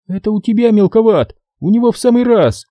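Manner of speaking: дерзко и высоко отбрить; резко осадить; отбрила. A narrator's reading. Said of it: "отбрила она". (Надя дерзко осаживает солдата)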